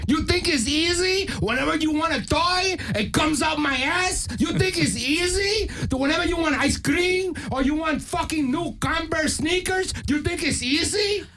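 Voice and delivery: hammed-up Puerto Rican-Mexican scolding mother; Puerto Rican-Mexican mom. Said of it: "like, a Puerto Rican Mexican mom".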